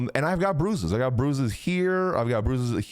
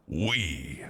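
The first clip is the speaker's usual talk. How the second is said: low wee; 9 semitones lower, 5 dB quieter than usual. Retold or imitated. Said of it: "He was like".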